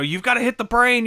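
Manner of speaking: lightly voiced frantic coworker; urging. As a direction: press them to aim for the brain. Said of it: "they're like".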